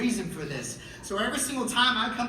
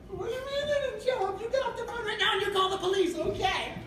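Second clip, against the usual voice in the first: a laughing, higher mock mom; mom on the phone. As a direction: play mom giggling while giving orders.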